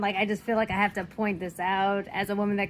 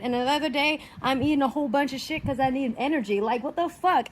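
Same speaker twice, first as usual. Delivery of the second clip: normal talking voice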